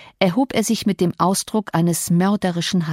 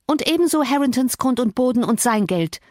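higher-pitched, carefree provocation; unconcerned; 6 semitones higher than usual. A narrator's reading. Cathy goes on provoking her father-in-law, unconcerned. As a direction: carry on regardless, pitched up. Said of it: "sprach sie unbekümmert weiter".

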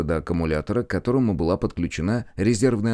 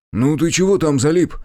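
louder, higher impatient question; 7 semitones higher, 6 dB louder than usual. A narrator's reading. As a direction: snap it out, impatient for an answer. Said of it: "нетерпеливо спросил Заварзин".

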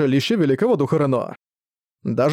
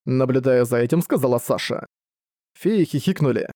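ordinary delivery

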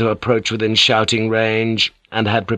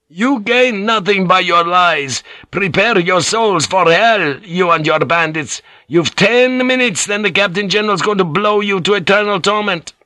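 shouting threat; 10 semitones higher than usual. Acted. Priest threatening the galley across the water, shouting. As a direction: bellow the threat across the waves. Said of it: "went to the gunwale and shouted".

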